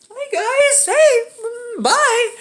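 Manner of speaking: high, squeaky awkward hello and bye; awkward greeting. Acted. Boy caught leaving by her mom and uncle, awkward.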